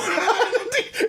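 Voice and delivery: high shouted laugh. Real, not acted. Laughing at own retold panic.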